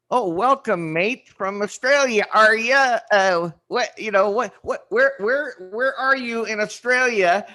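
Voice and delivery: put-on high, loud, muddled Australian accent; British-turned-Canadian attempt at an Australian accent; bad Australian imitation. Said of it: "that fucking Australian, like, imitation".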